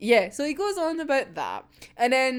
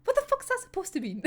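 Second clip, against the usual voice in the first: fed-up snap; 10 semitones higher, 5 dB quieter, faster than usual. Retold or imitated. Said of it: "who is so fucking fed up".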